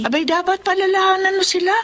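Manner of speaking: high, reproachful scold; scolding mother. Acted. Mother chiding her daughter for covering for friends, high sharp scold. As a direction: raise your voice to set her straight.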